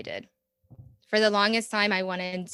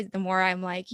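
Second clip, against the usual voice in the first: ordinary delivery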